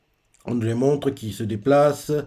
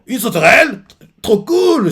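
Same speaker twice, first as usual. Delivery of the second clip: much higher, louder thrilled squeal; amazed delight; 10 semitones higher, 8 dB louder than usual. Retold or imitated. Luffy thrilled at the grasshopper bike, high squeal. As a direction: gush like a kid seeing a bug. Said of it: "comme quelqu'un qui a émerveillé par la chose".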